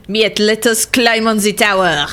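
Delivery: put-on light French accent, "the" as "ze"; a playful hint of a French accent; mild French accent while gaming. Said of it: "I need to stop speaking with the accent".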